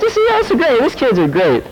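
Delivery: high, laughing delight; laughing quote. Retold or imitated. The friend laughs about the kids and their noses, high and laughing. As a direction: quote him through a laugh. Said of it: "He's like".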